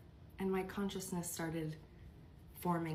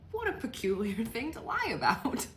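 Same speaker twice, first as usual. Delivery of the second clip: laughing through the words, louder; amused disbelief; 3 semitones higher, 5 dB louder than usual. Real, not acted. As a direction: break into a real laugh mid-sentence. Laughing at mom's odd lie.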